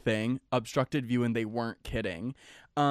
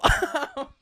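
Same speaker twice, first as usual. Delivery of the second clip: laughter; 13 semitones higher than usual. Real, not acted. Laughs after saying deal.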